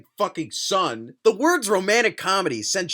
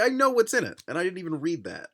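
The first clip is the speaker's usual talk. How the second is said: ordinary delivery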